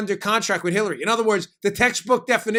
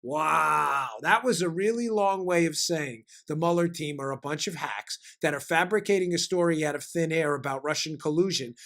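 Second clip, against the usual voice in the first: ordinary delivery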